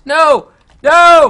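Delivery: loud, punchy cries of refusal; frustrated shout. Real, not acted. Player about to fail in game, frustrated shouting. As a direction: shout each one hard. Failing at the wrecking ball.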